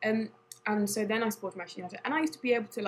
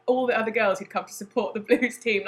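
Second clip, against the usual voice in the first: ordinary talk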